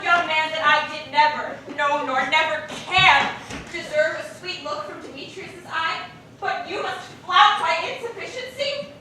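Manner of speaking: loud, hurt indignation; angry rant. Acted. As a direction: rail at him.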